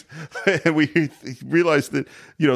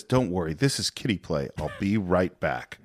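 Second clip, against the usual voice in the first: low, soft imitation of an unbothered hero; casual reassurance; 6 semitones lower, 5 dB quieter than usual. Hero calming a worried woman before leaving, low casual reassurance. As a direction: play it down quietly, slowing at the end.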